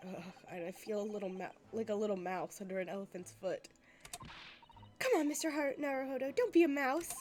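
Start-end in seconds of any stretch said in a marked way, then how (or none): 5.0-7.1 higher, louder, brisk encouragement; urging. Acted. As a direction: nudge a timid friend forward with a loud push. Companion urging him on.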